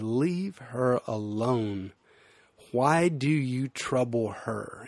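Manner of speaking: slow, calm, protective; lightly voiced Jesus, slow and firm; gentle defense. Jesus defending the woman against critics, slow and calm.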